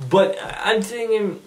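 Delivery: ordinary talk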